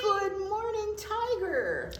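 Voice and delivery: high, laughing hello; bright greeting. Real, not acted. Teacher [name] greets Tiger, high and laughing. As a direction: greet the puppet with delight.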